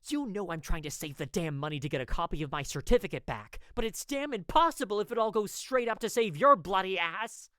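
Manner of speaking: furious scolding in the saloon. Acted. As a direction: keep yelling at him, hot and fast. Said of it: "As soon as I raised my voice".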